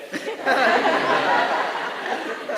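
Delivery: loud airy burst of laughter; breathy laugh. Real, not acted. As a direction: laugh out at himself. Laughs at his own story.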